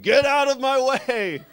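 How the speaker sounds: put-on high, loud, slow old-man yell. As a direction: bellow like a cranky old man clearing a path. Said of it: "he was yelling".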